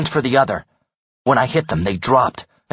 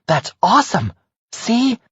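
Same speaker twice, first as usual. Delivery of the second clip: lightly voiced excited friend; bright happy praise, a bit higher; 11 semitones higher than usual. Jackie cheers the stolen vials.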